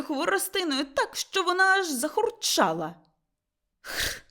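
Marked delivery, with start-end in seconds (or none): 3.8-4.2 short, soft mocking rasp; mocking rattle noise. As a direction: imitate a rattling stick in mockery. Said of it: "захурчав насмішкувато Лаврін". (насмішкувате хурчання)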